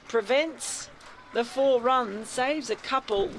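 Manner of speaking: lively ball-by-ball radio call; radio cricket commentator calling the over; cricket commentary. Acted. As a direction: call the play as it happens.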